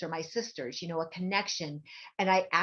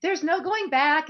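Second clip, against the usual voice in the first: high, louder, emphatic no-going-back; high firm psychic; 12 semitones higher, 7 dB louder than usual. Retold or imitated. The psychic telling her, high and emphatic. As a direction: state it high and loud, slowing at the end. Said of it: "she's like".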